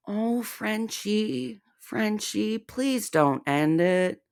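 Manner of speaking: high, slow mock pleading; sarcastic fake concern, drawn out; mock pity.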